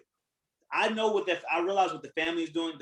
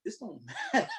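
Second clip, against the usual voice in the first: laughing; 7 dB quieter than usual. Retold or imitated. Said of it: "I'd be like".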